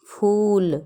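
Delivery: फूल with a drawn-out oo; long u. Shown as a demonstration. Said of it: "बड़ा ऊ की मात्रा".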